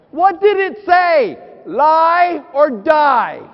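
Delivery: slow, loud, mock-panicked warning; loud dramatic build. Real, not acted. Husband warns the crowd loudly and slowly: lie or die. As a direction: drag it out like a life-or-death alarm. Wife beside him, tricky question.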